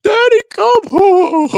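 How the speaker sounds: high, loud, slow mocking wail; mocking Grace's song in a squealing falsetto. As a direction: howl it like a whiny child. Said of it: "Talking about".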